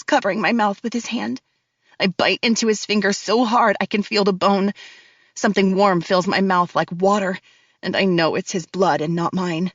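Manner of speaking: ordinary delivery, nothing marked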